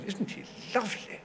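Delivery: soft, high imitation of a smitten duchess; Duchess of Devonshire. Duchess taken with a young actor, imitated soft and high. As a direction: coo it quietly in a put-on aristocratic lady's voice. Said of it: "she fell".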